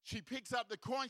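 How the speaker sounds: normal talking voice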